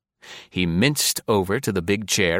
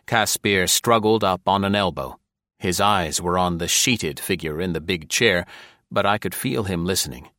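ordinary delivery

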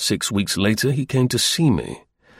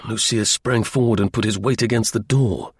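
plain reading voice

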